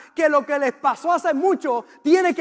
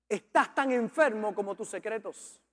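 said again much softer, letting it sink in; softer repeat; 4 semitones lower, 8 dB quieter than usual. Shown as a demonstration. Preacher repeating his point quietly to the congregation, softer. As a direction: drop the volume and repeat it gently. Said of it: "Lo voy a repetir otra vez".

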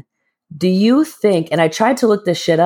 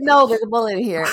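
high, laughing as she repeats it; giggling the line back; cracking up mid-quote; laughing through it; 6 semitones higher, faster than usual. Real, not acted. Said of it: "I said".

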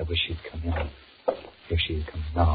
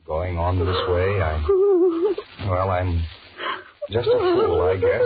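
cracking up, near tears, louder; voice breaking; 3 semitones higher, 8 dB louder, much slower than usual. Acted. Johnny breaking down while pleading with Patricia, voice cracking. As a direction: let the voice crack as he gives in.

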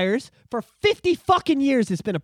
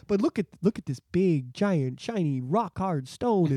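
lower, softer, very slow wonder; mock hushed awe; 6 semitones lower, 5 dB quieter, slower than usual. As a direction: marvel at the shiny stone. Mocking Trudeau's distraction.

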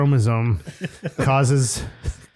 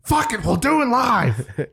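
Bill O'Reilly impression; 9 semitones higher, slower than usual. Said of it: "Bill O'Reilly impression".

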